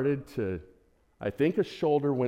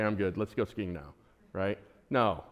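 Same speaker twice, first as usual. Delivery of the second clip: ordinary delivery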